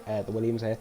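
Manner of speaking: ordinary delivery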